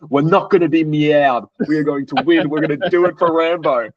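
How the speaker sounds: mock-fire up the team; hyped teammate. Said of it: "He goes".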